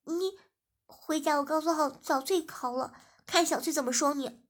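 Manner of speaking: playful threat. Acted. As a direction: threaten to tell on him, half joking. (撒娇地大声威胁)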